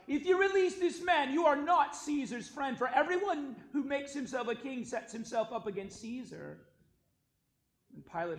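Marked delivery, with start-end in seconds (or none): 0.1-6.7 high, loud protest, easing slightly toward the end; crying out. A narrator's reading. Crowd warns Pilate against releasing Jesus, crying out. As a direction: shout a forceful warning over a crowd.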